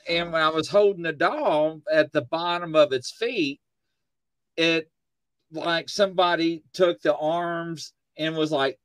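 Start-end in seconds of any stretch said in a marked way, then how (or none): none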